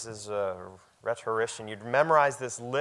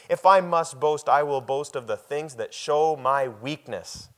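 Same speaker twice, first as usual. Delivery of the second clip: preaching at full force; 2 semitones higher, 7 dB louder than usual. Acted. Pastor to congregation, reading Paul's boast, loud and ringing. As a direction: proclaim each question like a challenge.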